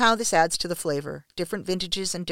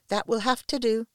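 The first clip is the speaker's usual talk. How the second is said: higher-pitched, gentle Scottish lilt; gentle Scottish accent; 5 semitones higher than usual. A narrator's reading. Cook approving the narrator's tied-back hair, gentle Scottish accent. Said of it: "in her gentle Scottish accent".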